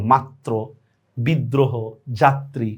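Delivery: মাত্র, বিদ্রোহ, যাত্রী as "mattro, biddroho, jattri"; doubled consonant. Shown as a demonstration. Teacher lists examples, মাত্র and the rest with doubling. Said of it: "দ্বিত্ব হয়".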